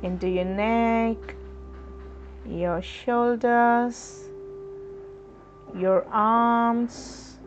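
usual speaking voice

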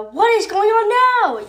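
high, loud yell running up; yelled question. Acted. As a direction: call out over the crowd. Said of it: "yelled Tsunami".

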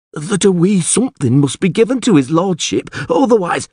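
high-pitched peasant voice; mumbling villager. A narrator's reading. Villager explaining the goose to the alderman, mumbling. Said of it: "mumbled the villager".

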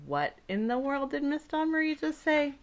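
high-pitched puzzled reaction; baffled listener. Acted. Listener reacts to the fancy French title, baffled.

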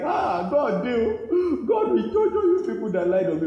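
loud, high mock wail; acted crying. Innocent prisoner calling God's judgement on liars, acted crying. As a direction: cry out against the accusers in a hammed-up wail. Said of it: "You know how that person will be crying".

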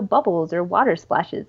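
ordinary delivery

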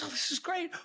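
mock-delighted frost spirit, hushed; Morozko amused. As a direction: savor it under your breath. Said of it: "He goes".

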